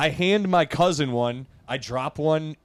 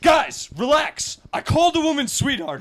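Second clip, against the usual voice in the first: higher, exasperated retold yell; mock-exasperated raised voice; 10 semitones higher than usual. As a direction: yell it back at the cousins, stretched and high. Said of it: "I yelled at all of my".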